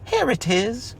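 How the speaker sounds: high, excited cry. Acted. Christopher Robin excitedly finds the present. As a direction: burst out with the find. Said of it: "cried Christopher Robin excitedly".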